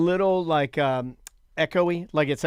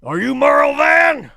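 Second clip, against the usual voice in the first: put-on gruff old-timer; 10 semitones higher, 8 dB louder, slower than usual. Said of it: "works better in the example".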